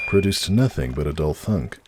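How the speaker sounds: plain reading voice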